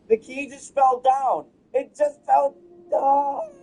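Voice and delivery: high slow wail of dismay; half-crying, half-laughing in disbelief; shocked laughter. Real, not acted. Key lost, mock crying.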